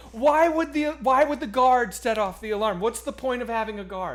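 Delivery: high, loud flustered pleading; panicked defense. Retold or imitated. Diner pleading with the angry manager, high flustered panic. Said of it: "I'm like".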